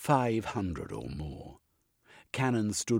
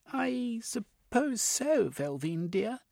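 put-on old lady voice; slower than usual. A narrator's reading. Lilibet voiced in story.

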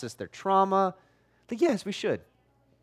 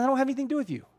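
Paul quoted; 8 semitones higher, much faster than usual. Retold or imitated. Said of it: "then he says".

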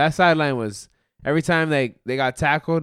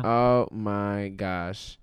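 usual speaking voice

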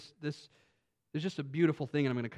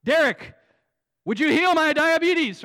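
much higher, louder pleading voice; mock plea; 11 semitones higher, 11 dB louder than usual. Pretending to ask a friend to heal him, loud and high. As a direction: ham up a desperate request. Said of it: "and I'm".

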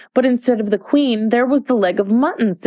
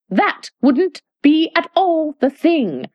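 high, firm and deliberate; very decided; 8 semitones higher, slower than usual. A narrator's reading. Red Queen overrules Alice's refusal, very decided and firm. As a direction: settle it, no arguing. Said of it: "the Red Queen said very decidedly".

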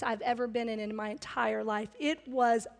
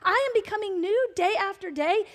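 higher, rising with wonder; excited; 6 semitones higher, 4 dB louder than usual. Real, not acted. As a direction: say it amazed, lifting on new. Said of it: "That blows my mind".

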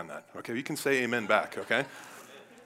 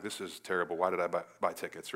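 lower-pitched, deflated fan; dejected grumble; 6 semitones lower than usual. Acted. Losing team's fans giving up, packing up.

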